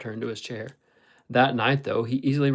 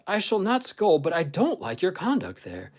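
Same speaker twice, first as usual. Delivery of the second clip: firm, high-pitched reproach; earnest reproof; 6 semitones higher, 4 dB louder than usual. A narrator's reading. Ellen tells Catherine she dislikes her conduct, reproving. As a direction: reprove them, starting high and loud.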